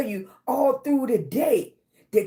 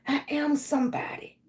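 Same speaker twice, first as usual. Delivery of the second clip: whispered; 2 semitones higher, 6 dB quieter than usual. Shown as a demonstration. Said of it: "repeats affirmation in a whisper".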